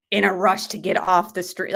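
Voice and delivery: usual speaking voice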